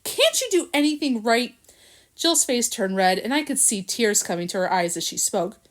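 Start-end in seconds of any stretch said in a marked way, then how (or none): 0.0-1.5 angry. A narrator's reading. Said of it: "he shouted".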